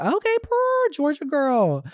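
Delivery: hyped reaction to a fan from Georgia; squealing excitement. Real, not acted. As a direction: squeal with delight. Excited reaction while reading note.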